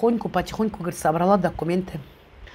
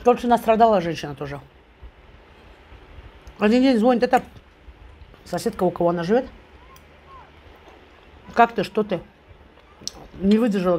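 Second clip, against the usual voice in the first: talking between bites; chewing while talking. Real, not acted. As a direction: mouth partly full of food. Seen 2: Bites pickle at -3, chews through the labelled words, then forks more food.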